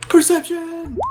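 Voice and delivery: high, loud excited call; excited shout. Real, not acted. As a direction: blurt it out eagerly. Excited wrong skill call.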